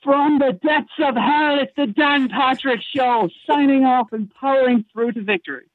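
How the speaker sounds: high, slow excited race call; squeaky commentator voice. Shown as a demonstration. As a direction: shriek it like the end of a race. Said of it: "could have had it a little higher pitched voice".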